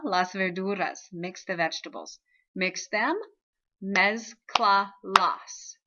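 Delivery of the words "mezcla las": "mezcla las" very slow and louder, in separate beats; syllable by syllable. Shown as a demonstration. Teacher counting out syllables, "mezcla las" slowly broken up. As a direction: break "mezcla las" into mez, cla, las. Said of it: "If I were to count out".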